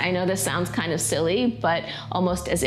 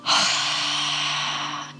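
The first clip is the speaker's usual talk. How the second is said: ocean throat breathing. Shown as a demonstration. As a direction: open mouth constricted exhale. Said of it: "We have".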